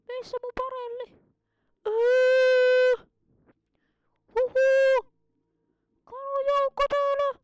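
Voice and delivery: high, excited cartoon voice all through; squeaky put-on voice. Kid gamer in a high silly voice reacting to the fish. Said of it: "miksi mä puhun tuolla äänellä".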